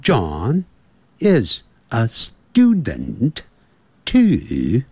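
slow British-accented model sentence; teacher demonstrating a British accent, word by word; British accent, deliberately drawn out; British English. Shown as a demonstration. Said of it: "英式英语是".

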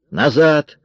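high, sharp order to get back; shouted command. A narrator's reading. Armed Kara orders Marion back, shouting. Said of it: "закричала она Марион".

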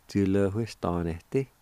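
"Ju le wi stan ne ti" run together as one word; joined-up, normal pace. Shown as a demonstration. Teacher giving the whole word for job, joined-up normal pace. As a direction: say Ju le wi stan ne ti smoothly at talking speed. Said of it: "if you say it fast".

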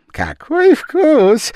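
very high, slow delighted cry; exclaiming. A narrator's reading. The Japanese man exclaiming to Madame Danton, very high and slow. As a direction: gasp with fake admiration. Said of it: "восклицал японец".

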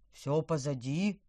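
slow shaky gurgle of a fish; gurgling alien voice. Acted. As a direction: gurgle it out, trembling. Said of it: "пробулькал он дрожащим голосом".

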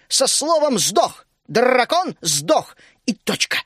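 loud, high raging tirade with slowed hammered words; furious rant. A narrator's reading. Teresa ranting at the guests about dragons, furious. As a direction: rage on, spitting every word. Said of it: "наливаясь гневом, закричала".

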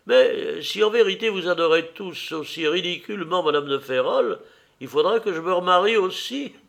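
high-pitched society lady; teasing old lady, high voice; lively old hostess. Acted.

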